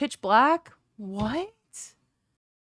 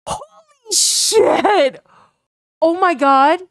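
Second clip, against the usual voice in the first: high, loud, drawn-out disbelief; stunned, the words pulled long; overwhelmed shout; shocked shout; 8 semitones higher, 9 dB louder, much slower than usual. Real, not acted. Stunned by flood of subs.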